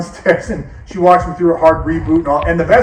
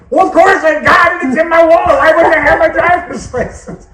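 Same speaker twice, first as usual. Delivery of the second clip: put-on high, loud indignant old man; scoffing at a silly question; offended dad shout; 13 semitones higher, 6 dB louder than usual.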